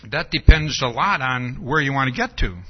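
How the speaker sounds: Cat quote, low and projected; lightly voiced Cheshire Cat. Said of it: "And the cat says".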